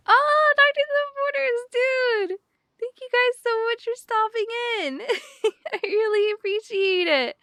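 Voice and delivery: high-pitched, delighted squeal of gratitude; excited gushing thanks. Real, not acted. Streamer thanking the visiting charity, excited and gushing.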